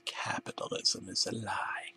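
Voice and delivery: soft unvoiced whisper. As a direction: breathe the secret. Whispered conspiracy.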